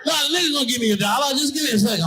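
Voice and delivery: high, fast, excited phone chatter; homeless guy on phone. Retold or imitated. Homeless man tells his caller to wait, high and fast. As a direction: rush him off the line.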